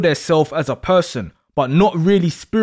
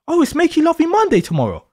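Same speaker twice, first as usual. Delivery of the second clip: playful teasing; 9 semitones higher than usual. Acted. Teasing partner mocking bedtime.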